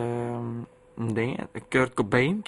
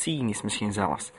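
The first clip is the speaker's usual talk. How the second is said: usual speaking voice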